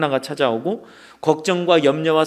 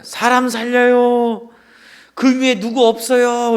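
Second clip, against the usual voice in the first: lightly acted shout for rescue; shouting for help; 7 semitones higher, slower than usual. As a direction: call up the cliff as if someone might hear. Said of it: "큰 소리로 힘껏 외치는 거야".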